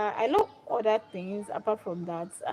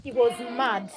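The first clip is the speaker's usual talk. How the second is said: plain reading voice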